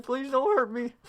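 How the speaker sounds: high, small mock-scared plea; hammed-up timid begging; meek pleading. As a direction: squeak it out like a frightened kid. Scared kid pleads with angry mom.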